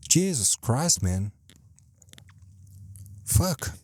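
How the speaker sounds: high-pitched swearing rant at himself; real, high-strung exasperation; exasperated outburst. Real, not acted. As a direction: let frustration push the voice way up. Exasperated outburst at own poor speech.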